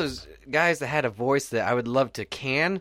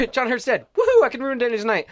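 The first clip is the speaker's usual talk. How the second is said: imitating a gloating friend, higher pitched; mock gleeful cohost; 11 semitones higher, 5 dB louder than usual. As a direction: cheer like a friend who loves spoiling the night. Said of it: "I can see you sit there".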